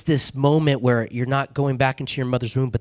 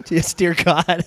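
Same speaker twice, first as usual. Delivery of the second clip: laughing; 6 semitones higher, 4 dB louder, slower than usual. Retold or imitated. Laughs while retelling own prayer.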